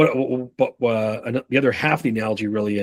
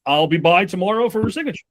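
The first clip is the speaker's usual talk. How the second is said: higher-pitched, easy eagerness; happy ears; 7 semitones higher than usual. Acted. Salesman too quick to promise a signature visit, eager higher voice. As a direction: jump at the yes, lightly acted. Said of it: "Happy ears is like".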